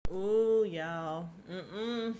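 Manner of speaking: irritated groan of disbelief; exasperated sigh to the listeners; real irritation. Real, not acted. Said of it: "I'm getting real irritated".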